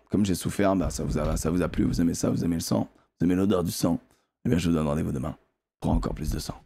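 voix basse et sourde de méchant; grave et théâtral. Acted. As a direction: menace sombre et posée, en jouant. Conclusion théâtrale aux spectateurs. (voix grave de méchant qui parle de sang)

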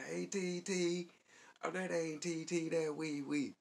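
high, loud mock holler, first words drawn out slowly. Girl hollering names through the window, high loud mock yell. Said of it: "hollered through the window".

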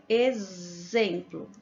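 exemplo said very slowly, x as z; slow demo word. Shown as a demonstration. Teacher working the first example with the class, very slowly. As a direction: stretch exemplo to show its z sound. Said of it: "O X tá com som de Z".

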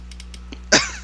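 breathy laugh. Real, not acted. Laugh at friend's line.

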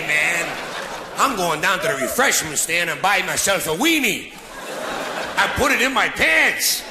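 hammed-up sleazeball ogling a redhead; lecherous fan. Leering guy in the crowd.

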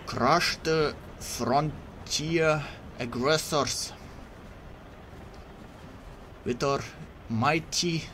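put-on hammy Russian commander accent. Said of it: "wannabe Russian voice acting impression by me".

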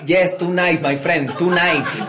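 high, loud broken English; hammed-up Spanish-accented English; broken Venezuelan English. Venezuelan host reassuring the German, high and broken English.

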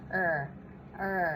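"เออ" as an R, tongue held up; English R sound. Shown as a demonstration. Teacher shows learners tongue position, "เออ" as English R. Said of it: "ลิ้นมันจะลอยค้างอยู่ข้างบน".

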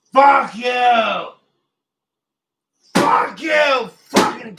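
angry shout. Real, not acted. Streamer yelling at whatever is touching him, angry shout. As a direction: spit it out in real rage.

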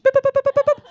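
high, loud, rapid boops on one note; imitated crossing beeps. Comic beeps out the crossing signal as lights change, imitated beeping. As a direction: beep like a pedestrian crossing.

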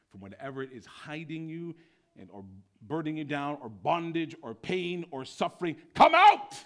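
building to a huge, high cry of come out; rising shout. Acted. Preacher calling the congregation out, building to a shout. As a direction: summon them out with full force.

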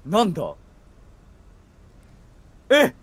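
ordinary talk

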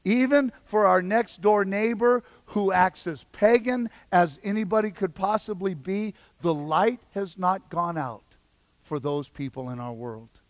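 usual speaking voice

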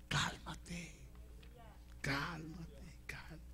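very soft, fading to a whisper; soothing hush. Acted. Preacher calming a restless believer, soothing hush.

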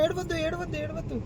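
high, soft hushing to stop the crying; soft high comforting murmur. Real, not acted. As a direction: coo gently so the little one calms down. Soothing a crying child.

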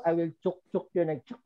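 ordinary talk

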